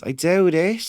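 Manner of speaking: authentic-style Cork accent; beautiful Cork accent. Shown as a demonstration. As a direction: show off a lovely Cork lilt. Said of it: "there's much more beautiful ones".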